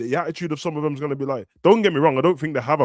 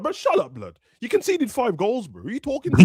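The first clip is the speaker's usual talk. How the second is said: mock angry comeback; 7 semitones higher than usual. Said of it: "I'm like".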